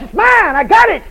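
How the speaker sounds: high, loud bold declaration; loud shouted claim of faith; loud confession. Shown as a demonstration. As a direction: shout it out as yours. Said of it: "make you confess".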